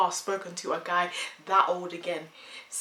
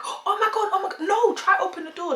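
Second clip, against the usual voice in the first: very high, loud panicked cry; panicked shriek; 13 semitones higher, 6 dB louder than usual. Retold or imitated. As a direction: shriek it in terror. Sister panicking.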